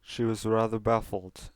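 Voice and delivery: plain reading voice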